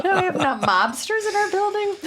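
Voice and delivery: high-pitched laughing question. Retold or imitated. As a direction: squeal it through laughter. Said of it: "I'm like".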